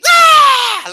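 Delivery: sudden high screaming yell; retold scream. Family member screaming at the TV, a piercing high yell. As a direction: shriek out of nowhere. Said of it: "heard people screaming".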